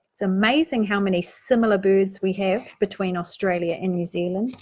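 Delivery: plain reading voice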